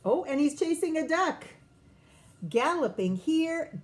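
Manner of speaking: usual speaking voice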